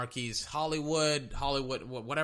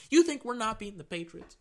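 high, brash talk-back; high cocky shut up; cocky quarterback voice; 8 semitones higher, 3 dB louder than usual. Retold or imitated. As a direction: play the QB snapping back at the fan. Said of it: "Lamar Jackson is saying".

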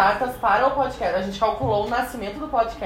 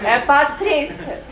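slow, higher "É Patrícia" showing the short answer; mock-patient demo of the right answer; slow correction; 4 semitones higher, much slower than usual. Shown as a demonstration. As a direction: draw out "É Patrícia" like a teacher. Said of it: "Tu ia falar toda a frase".

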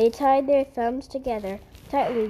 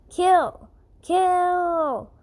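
loud savage yell, high and forceful; a mob's furious screamed chant; screaming; 6 semitones higher, 5 dB louder than usual. A narrator's reading. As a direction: scream it like a bloodthirsty mob. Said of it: "the boxers screamed".

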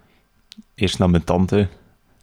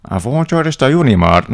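nosy aunt; 7 semitones higher, 5 dB louder than usual. Retold or imitated. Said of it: "Ik moest weer dezelfde vraag".

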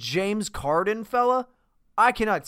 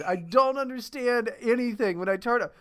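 normal talking voice

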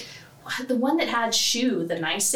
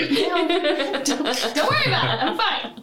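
bright squeaky sing-song welcome; 9 semitones higher, 3 dB louder than usual. Acted. Mock-manic echo of a friend's joke.

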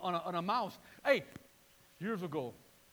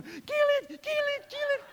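wife's panic; 7 dB louder than usual. Retold or imitated. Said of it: "Then my wife, right with them".

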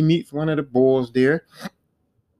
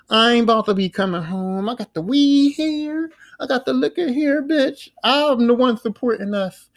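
mock defiant; 7 semitones higher than usual. She's telling Alma she won't come home, mock defiant. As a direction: play her refusing to come home, pitched up. Acted defiant phone refusal.

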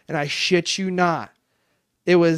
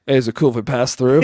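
put-on cheeky kid; 4 semitones lower, 6 dB louder, faster than usual. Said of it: "this kid leans in front of the camera and goes".

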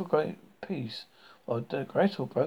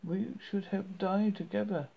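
high, pleading voice; tearful prayer; 6 semitones higher than usual. A narrator's reading. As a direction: beg heaven through tears. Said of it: "she exclaimed".

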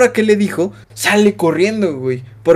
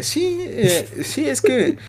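put-on higher, softer hesitant voice of a teary kid; mock tearful stammer; 7 semitones higher, 6 dB quieter, much slower than usual. He imitates his own fifteen-year-old self answering the teacher, teary and hesitant. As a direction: stammer like a hurt teenager holding back tears. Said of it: "Y yo como de".

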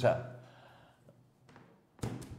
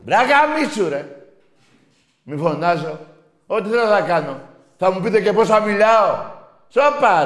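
high, very loud defiant yelling; real angry shouting; 10 semitones higher, 12 dB louder than usual. Real, not acted. Host refusing a caller's plea not to shout, angry yelling. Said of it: "Μη φωνάζει".

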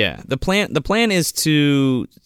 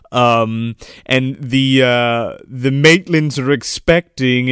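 usual speaking voice

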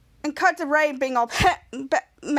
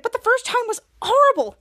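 higher, louder dismayed protest; 7 semitones higher, 4 dB louder than usual. Retold or imitated. Henry protests reliving the same day, dismayed protest. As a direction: object with mild dismay.

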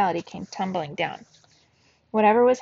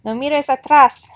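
loud, high, strange grating command; possessed grandmother shouting in a grating voice; 6 semitones higher, 12 dB louder than usual. A narrator's reading. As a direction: bark it out in a possessed voice. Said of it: "she shouted in a grating voice".

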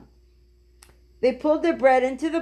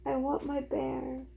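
put-on soft, drowsy child fading away; sleepy little voice; 3 semitones higher, 8 dB quieter, slower than usual. A narrator's reading. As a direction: mumble it half asleep, much softer each time. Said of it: "a little sleepy voice saying over and over".

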